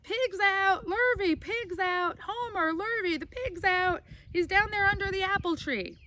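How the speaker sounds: high alarmed yell for the men; cried out. Acted. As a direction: call the men from the kitchen window. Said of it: "she cried".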